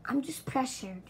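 ordinary talk

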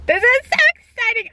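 high, thrilled burst; excited. Real, not acted. Gushing to viewers about her new dress, thrilled. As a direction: gush with excitement.

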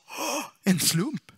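drawn-out mock discovery; mock amazement. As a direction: feign wonder after a sharp intake of breath. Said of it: "tittade in och sa".

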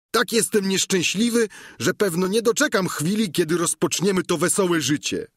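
a simple squire chattering cheerfully to his master; Sancho the squire. Acted.

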